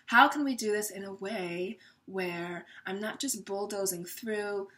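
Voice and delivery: soft tender queen voice; nurturing like the queen. Acted. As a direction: speak softly as a caring mother would. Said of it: "I'm even speaking like her, more passionate, more nurturing".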